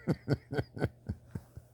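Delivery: wheezy, mostly voiceless laugh. Real, not acted. Laughing at his guinea pig comeback, soft wheezy laugh. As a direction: laugh softly at your own joke.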